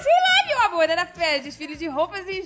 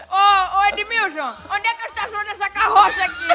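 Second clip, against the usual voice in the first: higher, fast imitated holler; calling-out valet; 4 semitones higher than usual. Valet asking coworker about the old car, calling out. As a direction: call across to a coworker as a mock valet. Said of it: "o manobrista falou".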